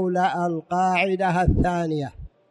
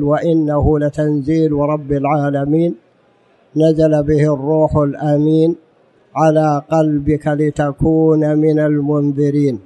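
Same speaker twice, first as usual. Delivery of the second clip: Quran recitation; 6 dB louder than usual. Real, not acted. Quoting Quran with raised voice.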